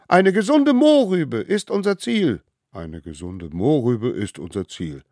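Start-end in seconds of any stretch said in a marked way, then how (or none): 0.1-2.4 high, loud solemn call; leading an oath. A narrator's reading. Smallest one calling the oath. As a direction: proclaim each word.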